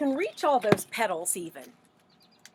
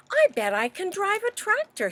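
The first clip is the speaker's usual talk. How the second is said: voice of Duck; 10 semitones higher than usual. Acted. Duck's boast voiced.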